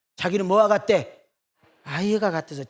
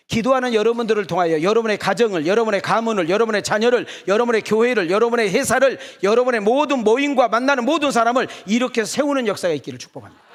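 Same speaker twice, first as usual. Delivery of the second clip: louder, higher rising benediction; fervent blessing; 4 semitones higher, 6 dB louder than usual. Real, not acted. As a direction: build each line of the blessing up to a peak. Pastor's building blessing. (점점 고조되는 축복 선포)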